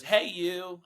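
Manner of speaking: imitating her joyful voice; joyful greeting. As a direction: greet a stranger like a best friend. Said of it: "joyful voice that I've ever heard".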